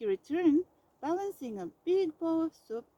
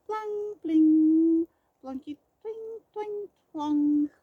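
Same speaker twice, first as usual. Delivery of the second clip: high plucky twangs; banjo strumming sounds; 4 semitones higher, 8 dB louder, much slower than usual. A narrator's reading. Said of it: "Mouse strummed hard".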